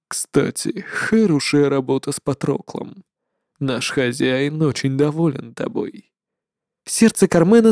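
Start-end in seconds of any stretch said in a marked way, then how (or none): 0.1-6.1 low, soft sinister praise; quiet menacing overlord; shadow lord. Acted.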